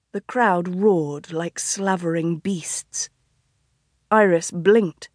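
usual speaking voice

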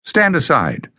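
commanding. A narrator's reading. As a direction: bark the order to step aside. Bada orders the guard aside.

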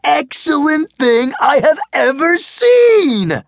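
high, loud raving praise; joyful shout. Acted. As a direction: gush it out at full volume. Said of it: "shouted Max joyfully".

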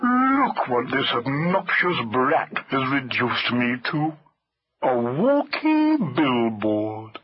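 very slow, ponderous elephant voice; slow, long-suffering talking elephant; aggrieved elephant. A narrator's reading. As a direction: drag the words out in wounded dignity. Hamlet the elephant complains.

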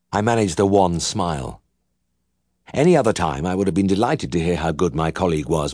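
ordinary delivery

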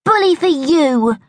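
high, yawned-out unimpressed retort; yawning. A narrator's reading. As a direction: say it through a bored yawn. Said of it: "yawned Bertie".

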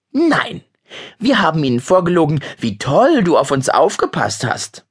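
put-on a kid holding the grown-up to the deal; young boy accusing his older cousin; indignant boy.

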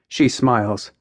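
usual speaking voice

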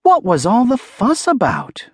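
high-pitched puzzled question; wondering. A narrator's reading. Otis puzzled by the farmer's hurry, wondering. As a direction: wonder aloud, pitched well up. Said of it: "Otis wondered".

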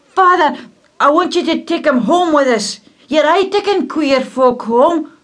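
put-on high, loud boy's plea; a lad begging his father; pleading boy.

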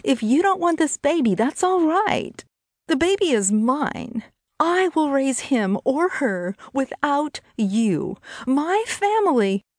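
high-pitched, emotional declaration; upset defiance. Acted. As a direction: stand up to him in a high, distressed voice. Brittany defies him over baby.

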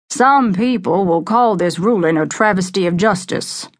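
plain reading voice

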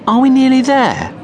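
high, loud pleading question; impatient child. A narrator's reading. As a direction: whine like a bored child.

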